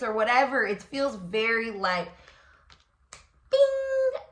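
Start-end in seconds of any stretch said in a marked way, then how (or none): 3.5-4.2 high bright bing; ping it like a light switching on; light bulb bing. Pretend. Reader mimics the light bulb moment with a high bing.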